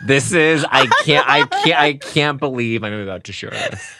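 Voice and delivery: ordinary delivery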